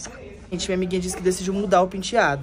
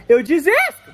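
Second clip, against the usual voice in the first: exasperated outburst; 6 semitones higher, 9 dB louder, slower than usual. Real, not acted.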